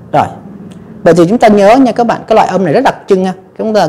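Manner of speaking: ordinary talk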